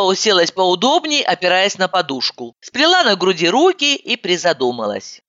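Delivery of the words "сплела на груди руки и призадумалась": plain reading voice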